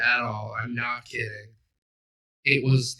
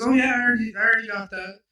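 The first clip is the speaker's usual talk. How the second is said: higher, louder breezy brush-off; imitating Ewan's brush-off; 9 semitones higher, 5 dB louder, faster than usual. Imitating Ewan dodging the store sales trainee, breezy and pitched up. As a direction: toss it off brightly to get rid of her. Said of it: "Ewan says".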